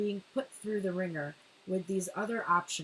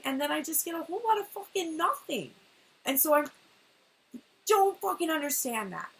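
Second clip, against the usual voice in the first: high, loud, exasperated complaint; pissed off; 8 semitones higher, 6 dB louder than usual. Retold or imitated. The feminine venting at the universe, pissed off and loud. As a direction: vent the anger straight out. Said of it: "this is a pissed off energy".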